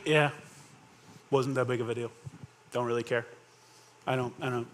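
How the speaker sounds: low, soft shrug of a voice; indifferent. Acted. Imagined indifferent reply of the nine.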